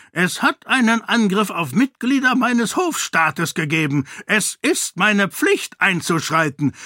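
stern king. A narrator's reading. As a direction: lecture her about duty. King defends his attack.

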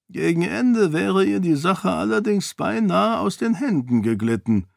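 a grudging complaint; grumbling duke. A narrator's reading. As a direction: duke admitting defeat to Kiyin. Duke remarks.

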